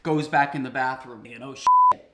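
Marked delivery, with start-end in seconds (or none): 1.4-2.1 very high, loud panicked yelp; high squeak of alarm. Retold or imitated. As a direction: squeal it in shock. Panicked realization.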